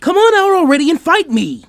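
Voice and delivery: very high, loud battle cry; taunting yell. Acted. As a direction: scream it across the garden. Taunting call to hidden foe.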